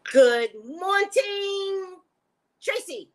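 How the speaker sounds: a very slow, cracking "Good morning, Tracy"; show greeting. Shown as a demonstration. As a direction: drag out "Good morning" like a crooning radio host. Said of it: "This is how I'm gonna pronounce my morning show".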